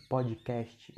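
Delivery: podcast said as "pódjikésti" with a final I; "podcast" with the I pulled onto the end; Brazilian mispronunciation. Shown as a demonstration. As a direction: stretch podcast into pod-ji-kes-ti. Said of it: "se você fala desse jeito".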